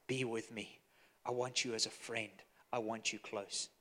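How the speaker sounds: almost murmur it, with longing; tender plea. Acted. Jesus tenderly asks disciples' closeness.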